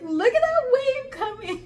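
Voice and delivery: high, loud warning cry, acted out; alarmed shout. Warning friend on rocks about a wave, alarmed shout.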